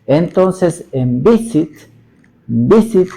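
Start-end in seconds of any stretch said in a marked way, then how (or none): 1.2-3.1 visit with VI punched high and loud; stress on first syllable. Shown as a demonstration. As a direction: hit the VI in "visit", let "sit" drop. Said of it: "aquí yo le tengo la mayor fuerza de voz".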